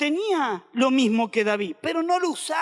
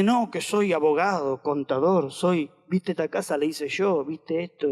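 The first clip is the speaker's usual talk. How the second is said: mock bragging; 9 semitones lower than usual. Mocking boastful believers' bragging.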